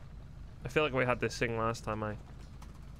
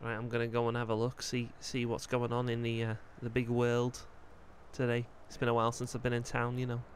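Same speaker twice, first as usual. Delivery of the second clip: put-on light Scouse accent as the character Joel; easygoing Liverpool lad in a Scouse voice; 4 dB quieter than usual. Joel chatting with Cyrus, relaxed Scouse accent.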